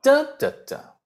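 three beats of 的, punch the first; stress on first syllable. Shown as a demonstration. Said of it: "如果是三音节的话".

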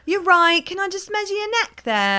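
high, sing-out mock Leeds accent. Shop assistant asking to measure a neck, high Leeds accent. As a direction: pitch it right up in a Leeds accent. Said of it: "I used to be able to do the accent".

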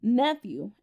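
ordinary talk

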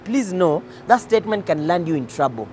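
usual speaking voice

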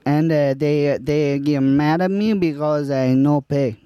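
light put-on Spanish accent. Improv scene as Juan.

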